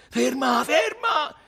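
very high, loud yells; frantic high-pitched cry; urgent shout. A narrator's reading. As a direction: scream for the car to stop. Desperate man demands the car stop.

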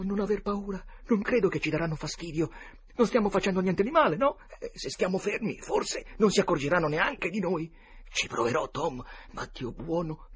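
put-on high, tense boy's voice, frightened; high frightened kid voice, on the edge of tears; scared boys. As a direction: play a terrified boy hiding among graves.

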